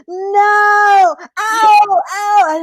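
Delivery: high, loud storybook yelps; hammed-up wail of hurt; acted pain cries. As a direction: yelp as if whacked. Coyote hit with a branch.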